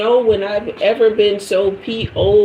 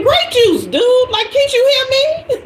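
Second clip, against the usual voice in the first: much higher, pleading inner voice; shrill inner voice. Retold or imitated. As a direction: shout it in your head, high and desperate. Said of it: "in my mind".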